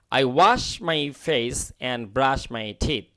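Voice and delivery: slow, clear model sentence; slow model reading. Shown as a demonstration. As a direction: read it out slowly for learners. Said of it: "teacher reads model sentence". (ধীরে ধীরে পড়া বাক্য)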